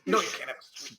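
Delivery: lightly acted parental refusal; parent refusing. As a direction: play a parent turning down a child. Replays parent refusing the child.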